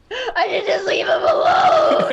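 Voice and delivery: sing-shout the line like a diva overcome; belting the lyric. Retold or imitated. Singing along to Tears Dry on Their Own, belted high and loud. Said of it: "and I was like".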